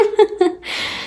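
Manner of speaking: short unvoiced chuckle; a quick laugh at her own joke; airy little laugh after bebekten şebekten; breathy laugh. Real, not acted.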